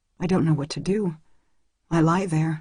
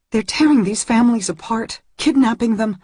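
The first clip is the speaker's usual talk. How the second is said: higher and louder, indignant; outraged; 6 semitones higher, 4 dB louder than usual. A narrator's reading. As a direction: press the point in outrage. Angry girl argues with Kai.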